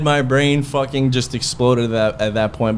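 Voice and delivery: ordinary delivery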